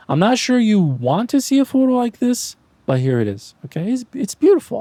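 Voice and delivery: hammed-up showman; mock hype. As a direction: sell a dull leaf like a wonder. Hyping leaf photo mockingly.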